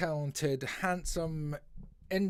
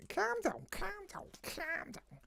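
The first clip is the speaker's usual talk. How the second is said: very high, softer mock Scouse; hammed-up Scouse, high and trailing softer; terrible Scouse accent; 5 dB quieter than usual. Shown as a demonstration. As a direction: squeak it like a comic Liverpudlian. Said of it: "Scouse accent".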